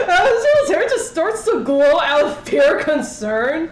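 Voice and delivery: high, slowed by laughter; laughing narration. Real, not acted. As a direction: narrate while cracking up, really laughing. Player cracks up while narrating.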